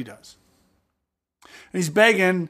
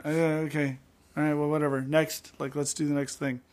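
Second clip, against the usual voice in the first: casual, softer brush-off; dismissive shrug; 2 semitones lower, 7 dB quieter than usual. Retold or imitated. Host mimics someone skipping ahead, dismissive shrug. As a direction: toss it off, ready to move on.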